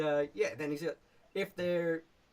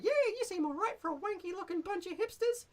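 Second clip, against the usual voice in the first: much higher and louder, a cheeky goblin sentry; brash goblin challenging strangers at the bridge; 4 dB louder than usual. Shown as a demonstration. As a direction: squeak it out like a rude little goblin guard. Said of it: "that's where he's like".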